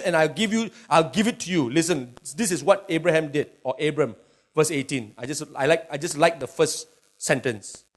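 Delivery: usual speaking voice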